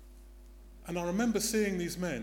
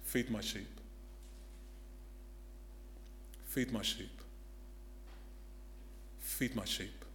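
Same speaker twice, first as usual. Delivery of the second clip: gentle command; 6 semitones lower, 10 dB quieter than usual. Acted. Jesus gently charging Peter, low and soft. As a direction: say it tenderly, lower. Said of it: "Then Jesus says to him".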